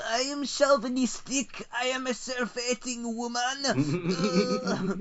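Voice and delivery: very high, hammed-up French caricature; mock French person. French PM denouncing herself, absurdly high mock French voice. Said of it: "then the French person is like".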